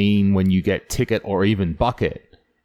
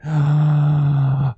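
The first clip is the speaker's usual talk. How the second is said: sudden panicked intake of breath; a startled mock gasp; sharp gasp; 5 semitones higher, 3 dB louder than usual. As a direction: gasp as you realise the mistake. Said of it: "and I went".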